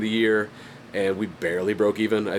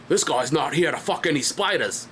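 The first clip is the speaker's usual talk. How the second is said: put-on Aussie accent, pitched up; higher-voiced mock Australian; 6 semitones higher than usual. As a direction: imitate an Australian bloke's accent. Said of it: "my favorite Australian phrase".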